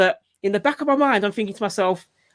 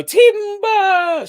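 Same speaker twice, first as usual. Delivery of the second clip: higher, bright "Timber!" called out; playful call; 12 semitones higher, 3 dB louder than usual. Pretend. Dad voicing his toddler toppling off the couch, high and playful. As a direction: announce the fall like a tree coming down. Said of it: "him gonna go".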